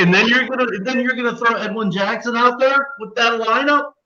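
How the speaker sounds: high-pitched incredulous question. Real, not acted. Host questioning co-host's bet on the Tigers, incredulous. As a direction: pitch it way up in real disbelief. Arguing against betting on the Tigers.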